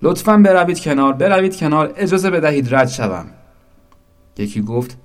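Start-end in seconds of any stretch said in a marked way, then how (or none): 0.0-3.2 high, loud plea to get through; loud call. A narrator's reading. The crow startles the crowd asking to pass, loud call. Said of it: "با صدای بلند که برای همه غیر مترقبه بود".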